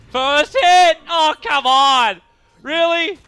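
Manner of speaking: very high, loud outburst of disbelief; shocked shouting. Real, not acted. Caster reacting to an unlucky first-hit bash, shouting in disbelief. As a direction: cry out in disbelief, drawing words out slowly.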